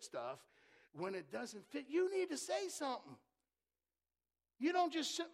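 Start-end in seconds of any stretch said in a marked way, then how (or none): 1.9-5.3 high, loud urging; raised exhortation. Real, not acted. Preacher urging the church to speak up, high and loud. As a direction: lift the voice and press them.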